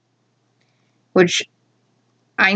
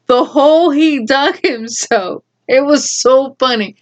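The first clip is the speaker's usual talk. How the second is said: ordinary talk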